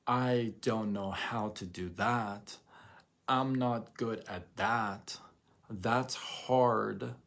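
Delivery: slow, defeated excuse-making; mildly whiny excuse. Retold or imitated. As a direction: drag it out like giving up. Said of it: "there was a time you could say".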